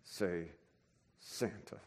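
very soft, dreading; a silent prayer under the breath; muttered dread that fades away; inner plea. Retold or imitated. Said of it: "my head, I'm like".

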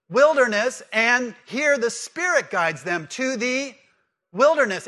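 loud, high, drawn-out build to each key word; pause, then punch out each repeated word; emphatic preaching. Real, not acted. Preacher leading congregation through the wilderness parallel, loud and emphatic. Preacher drives home parallel, audience filling blanks.

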